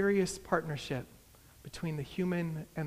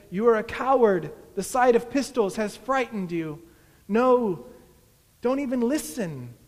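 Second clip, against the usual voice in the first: loud, raised denunciation; scornful accusation; 4 semitones higher, 9 dB louder than usual. A narrator's reading. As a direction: denounce them forcefully, pitched up. Imagined accusation voiced in story.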